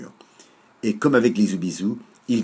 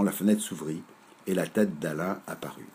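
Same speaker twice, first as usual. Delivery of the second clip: plain reading voice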